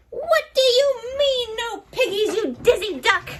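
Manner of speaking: high, loud furious hag; witch screech. A narrator's reading. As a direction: screech right into his bill. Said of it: "Gritch screeched into his bill".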